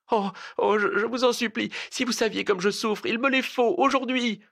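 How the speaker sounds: high, weepy supplication; tearful stammering plea. A narrator's reading. Harried cousin imploring him for help, weeping and stammering. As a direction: beg through tears, stumbling over the words. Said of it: "Elle pleurait et bégayait".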